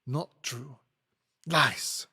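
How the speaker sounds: put-on terse French-accented contradiction. Hortense contradicts the lawyer, French accent. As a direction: deny it sharply in a French accent. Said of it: "apologize for my French accent".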